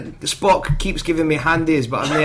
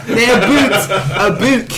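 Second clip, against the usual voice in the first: laughing; 3 semitones higher, 5 dB louder than usual. Real, not acted. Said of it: "Someone else say the word".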